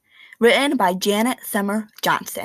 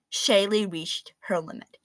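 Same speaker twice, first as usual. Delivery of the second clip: plain reading voice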